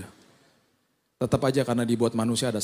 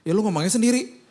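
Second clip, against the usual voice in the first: a higher, louder brush-off to a little brother; annoyed brush-off; 8 semitones higher, 3 dB louder than usual. Retold or imitated. Older brother brushing off his sibling's request, annoyed. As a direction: wave the kid off, telling him to ask himself.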